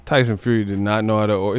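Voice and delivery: ordinary talk, nothing marked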